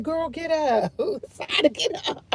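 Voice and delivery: a soft, half-laughing nudge to wake up; gentle wake-up call. Retold or imitated. Coaxing her sleepy sister out of bed, softly. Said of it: "I be like".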